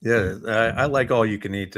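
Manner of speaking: usual speaking voice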